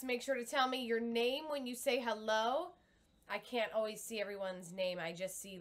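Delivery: ordinary talk, nothing marked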